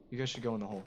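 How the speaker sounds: ordinary talk, nothing marked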